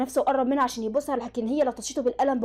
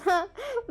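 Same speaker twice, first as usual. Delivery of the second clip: short high sneering chuckle; a quick wicked giggle; villain snickering at his victim; sly laugh; 9 semitones higher than usual. Acted. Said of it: "ابتسم لها بخبث".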